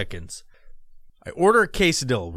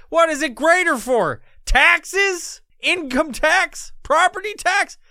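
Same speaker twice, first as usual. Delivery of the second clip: high and loud, mock-outraged questions; indignant rant; 6 dB louder, slower than usual. Real, not acted. Ranting to the listener about Northwest taxes, high and loud. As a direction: bristle at every tax, pitch climbing.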